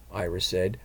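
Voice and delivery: ordinary delivery, nothing marked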